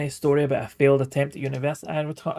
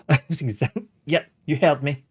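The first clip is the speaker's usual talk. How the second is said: laughing, a little louder; 3 semitones higher, 5 dB louder than usual. Real, not acted. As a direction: chuckle through the words, pleased with yourself. Laughing at own in-game insult.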